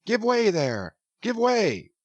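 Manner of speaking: jolly urging. A narrator's reading. Stubb cheering his crew to pull harder, jolly. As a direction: egg the crew on merrily.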